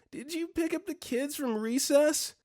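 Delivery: high, whining sleep-talker; distressed whine. Retold or imitated. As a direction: ask it high and fretful, as if distressed. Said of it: "Distressed whining noises".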